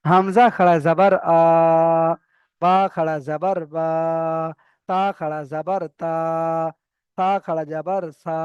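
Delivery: letter drill ending on a stretched "আ", "বা", "তা"; slow, measured spelling, hold each final syllable like "বা" long; drawn-out vowel. Shown as a demonstration. Teacher shows students the khara zabar letters, final "বা", "তা" drawn out. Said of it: "একালি পরিমাণ টান হবে".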